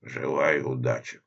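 low, hollow grave voice; sepulchral voice. Acted. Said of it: "загробным голосом произнёс Эдуард".

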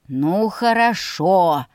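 slow, drawn-out reluctant agreement; giving in. A narrator's reading. Brother yielding to his pouting sister, giving in. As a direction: stretch the words as you give way. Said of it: "сдался брат".

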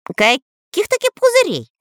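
incredulous spy; spy amazed at the bubble plan; surprised. A narrator's reading. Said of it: "удивился шпион Дырка".

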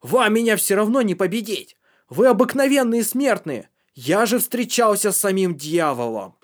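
boastful shouting. Acted. Drugged boy boasts, shouting.

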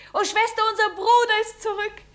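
high-pitched joyful realisation; overjoyed. Acted. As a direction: tell your sister the wonderful news, pitched high. Joy at brother's return.

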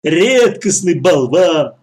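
loud, drawn-out mockery; jeering brothers. A narrator's reading. Brothers mock the boy as a blockhead, jeering. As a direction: stretch the insult slowly and loudly.

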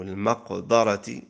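المقدرة said with "a" after the dal, maqdarati; fatha on the dal. Shown as a demonstration. Teacher listing a word's three vowelings, fatha on the dal of المقدرة. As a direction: sound المقدرة as maq-DA-ra. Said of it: "التي جاءت بالفتح".

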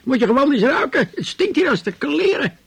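high, loud shout at the neighbours; yelling in outrage; retold shout. Old man yelling about the stink in the hallway, loud and high. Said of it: "riep ik".

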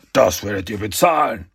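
angry villain threat; wütend. Acted. Shredder turns back to threaten the Turtles, angry. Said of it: "sagte mit wütender Stimme".

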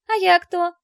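high, loud, eager question; delighted child. A narrator's reading. Little daughter demands her own nickname, thrilled. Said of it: "подпрыгнула от восторга Ника".

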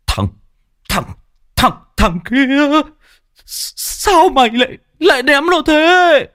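put-on high, loud stuttering old teacher; old man stammering scold. Old teacher stutters at the boy who threw his frog.

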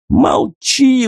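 a cornered thug shrieks at his captive, squealing; shrieking. A narrator's reading. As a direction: squeal it in terror. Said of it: "взвизгнул Тихон".

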